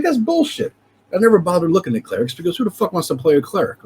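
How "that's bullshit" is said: high incredulous scoff; scoffing disbelief. Retold or imitated. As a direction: squeak it out in mock outrage. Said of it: "I'm like".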